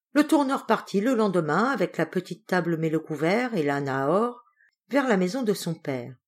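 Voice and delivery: usual speaking voice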